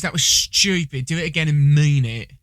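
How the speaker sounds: shaky attempt at English accent. Imitating friend directing a retake, mock stern English accent. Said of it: "not my best English accent".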